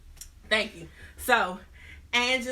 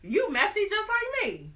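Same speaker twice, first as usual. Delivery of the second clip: much higher gleeful squeal; mock delighted; 13 semitones higher, 4 dB louder than usual. Hamilton delighted his lover is also married, mock gleeful. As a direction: delight in the scandal.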